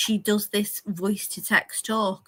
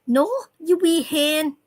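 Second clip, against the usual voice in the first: higher, Scots "wee hen"; Scots accent; 7 semitones higher, 4 dB louder than usual. Shown as a demonstration. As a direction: play a cheeky Glasgow woman. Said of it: "typical Scots accent".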